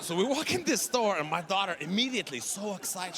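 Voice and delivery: normal talking voice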